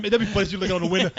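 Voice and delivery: usual speaking voice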